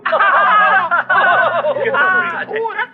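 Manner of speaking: loud, long guffaw; uproarious high laughing fit; big laugh. Acted. Hosts laugh at standing in line.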